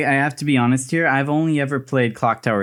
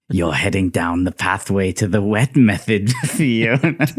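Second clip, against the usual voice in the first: Lorenzo; 5 semitones lower than usual. Retold or imitated. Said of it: "I was quoting Lorenzo from the game".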